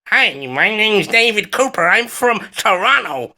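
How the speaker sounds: teasing impression of his co-host, introducing himself as him; David Cooper impression. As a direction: mimic your co-host's Canadian way of talking, slightly drawn out. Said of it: "let me do a David Cooper voice".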